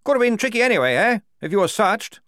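high, loud, bluff officer's voice; booming jolly commander; hearty major. A narrator's reading. The major greeting the squad, loud and bluff.

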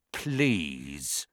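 put-on reluctant grumpy old man; grudging low please. A narrator's reading. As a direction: force it out, sullen.

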